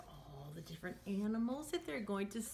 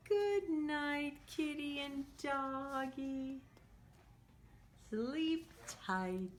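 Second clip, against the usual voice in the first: sing-song storybook read for little ones; lively picture-book voice; reading to children; 4 semitones higher, slower than usual. Acted. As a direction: read it like a teacher at story time. Teacher reads picture book aloud.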